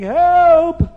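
high, loud, drawn-out cries; screaming for help. A narrator's reading. Nimrod drowning in egg, screaming for help. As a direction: yell in panic as the drowning king. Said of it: "Nimrod is screaming".

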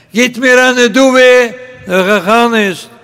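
loud, high, drawn-out begging cry; beggar's call. Acted. Beggar demanding alms from passers-by, loud drawn-out call. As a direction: stretch the plea out like a street beggar.

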